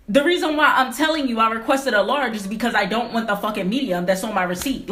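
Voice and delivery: ordinary talk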